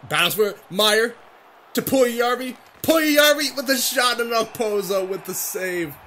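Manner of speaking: high-pitched, urgent shot call; excited play-by-play. Real, not acted. Player commentates Puljujärvi's overtime shot, tense excitement. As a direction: call it high as the chance builds.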